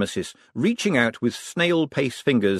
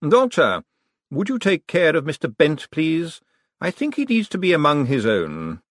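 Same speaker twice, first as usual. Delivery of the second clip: higher-pitched polite authority; smooth, raised-pitch courtesy; Vetinari; 6 semitones higher than usual. A narrator's reading. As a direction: make a courteous request that is really an order.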